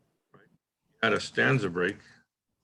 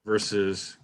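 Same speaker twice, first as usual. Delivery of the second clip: usual speaking voice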